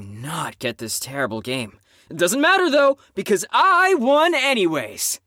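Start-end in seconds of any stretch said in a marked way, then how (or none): 2.1-5.2 high, smug and cocky boast; smug gloating. Acted. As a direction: rub the win in their face. Gloating after winning game.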